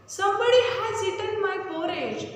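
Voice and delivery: lightly voiced mother bear calling out; mummy bear shouting. A narrator's reading. Teacher reading the story to pupils, mummy bear's light mock shout. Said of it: "Mummy Bear also shouted".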